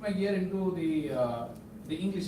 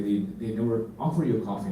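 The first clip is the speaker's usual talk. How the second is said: ordinary delivery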